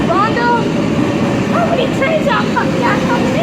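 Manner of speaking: high, loud call to a friend. Real, not acted. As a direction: shout it across the platform. Calls across the platform to Rhonda.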